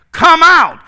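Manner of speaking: high, loud order; commanding shout. Acted. Preacher as Jesus casting out demons, loud. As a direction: bellow the command. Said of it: "Jesus commands".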